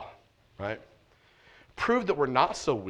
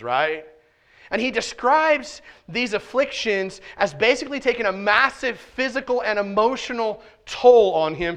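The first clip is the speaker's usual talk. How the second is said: impassioned, higher, louder preaching; full-force preaching; 9 semitones higher, 9 dB louder than usual. Acted. Pastor to congregation about David's afflictions, impassioned preaching. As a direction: preach it hard to the back row.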